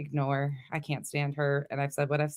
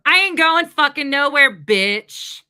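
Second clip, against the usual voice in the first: loud, raised, hard-hitting defiance; defiant outburst; 6 semitones higher, 8 dB louder than usual. Real, not acted. Streamer tells critic Molly she is staying, loudly defiant. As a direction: fire it back at a critic, loudly.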